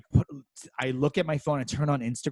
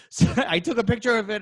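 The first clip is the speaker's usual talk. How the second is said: ordinary talk, nothing marked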